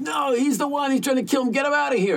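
very high, loud, desperate shriek; retold frantic scream. Confused Jake begs paramedics, frantic high scream. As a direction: scream to get him thrown out. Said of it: "he started screaming".

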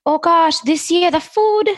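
high, sing-song Trinidadian mother; high-pitched put-on Trinidadian accent; mom's Trinidadian accent. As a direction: impersonate your Trinidadian mom gossiping. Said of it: "that's my mom talking".

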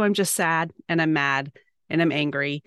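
usual speaking voice

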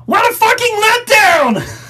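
high, slow, strained outburst; Bobcat voice. Retold or imitated. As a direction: squeal out the disappointment. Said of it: "I apologize for Bobcat".

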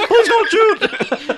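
very high, slow pleading clown squeak; silly clown voice. Retold or imitated. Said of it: "breaking out a clown voice".

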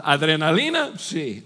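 normal talking voice

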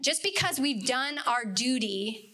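usual speaking voice

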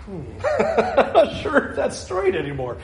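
a laughing, unsure squint at the ruler; half-laughing, doubtful; amused uncertainty, lightly voiced; doubtful chuckle. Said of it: "hold it up and go".

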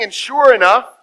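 ordinary delivery

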